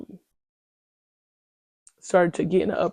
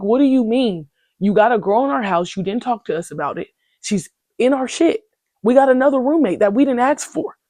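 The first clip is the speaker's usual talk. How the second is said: louder, higher, heated rant; angry rant; 5 semitones higher, 4 dB louder than usual. Retold or imitated. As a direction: lay into the roommate, heated. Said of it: "So I'm tweaked out".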